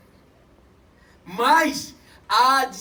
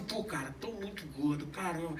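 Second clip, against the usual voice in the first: much lower, much softer grumble; gloomy complaint; 9 semitones lower, 11 dB quieter than usual. Retold or imitated. Someone at the mirror moaning about weight, low and soft. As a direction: sulk at the mirror. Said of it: "fala assim".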